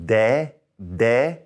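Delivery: "De" with the tongue pushed forward; Spanish de syllable. Shown as a demonstration. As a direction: sound out "de" with a forward dental D. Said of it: "Now remember that D".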